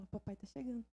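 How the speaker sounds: soft, hushed little voice voicing the baby; hushed baby thought. Voicing the baby's thought, soft and hushed. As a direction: whisper it as if the baby knows.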